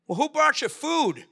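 high, put-on voice, jokingly indignant with his mouth full; Peter's mock reply. Peter answering with bread in hand, high mock voice. Peter quoted mid-meal, retold.

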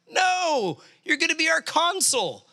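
high, insistent retold correction; emphatic correction. Ambassador correcting Bob about the job, emphatic correction.

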